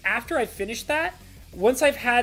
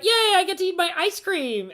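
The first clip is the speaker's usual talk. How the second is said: put-on much higher, giddy child voice; little kid; 11 semitones higher, 3 dB louder than usual. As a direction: squeal it like an excited little kid. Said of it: "when you would be a little kid ... you'd be like".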